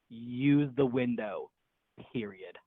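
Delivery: slow, deliberate, dropping low at the end; curt text readout. Retold or imitated. Girl texting him an escape order, slow and curt. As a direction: read out a blunt text word by word.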